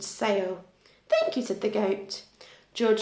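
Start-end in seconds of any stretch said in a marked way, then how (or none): 1.1-1.4 squeaky, much higher thank-you; a tiny high-pitched thanks; high grateful thanks. A narrator's reading. Grateful goat thanks George.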